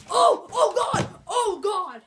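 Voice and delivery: high, loud pretend panic; mock alarmed cry. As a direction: play the cat yelling under attack. Voices Boo the cat being jumped.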